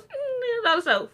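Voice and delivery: very high-pitched, giggly imitation; Rumple as Dark One. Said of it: "He goes".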